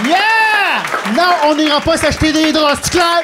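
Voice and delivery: high, loud shouted punchline; shouting finale. Acted. Comedian shouting the Bluetooth callback to the crowd, high and loud. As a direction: yell the closer to the crowd, high.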